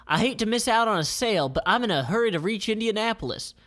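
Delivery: high, quick polite excuse; Jackie's excuse. Acted. Jackie dodging the sale, quick high. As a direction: rush the excuse out. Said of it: "Jackie says".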